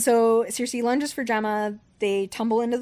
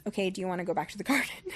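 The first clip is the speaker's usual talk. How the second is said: amused offhand offer; 5 dB quieter, much faster than usual. Retold or imitated. Recapper voicing the Gorgon's offer to Gemma, amused and offhand. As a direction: toss the question off softly and fast, amused.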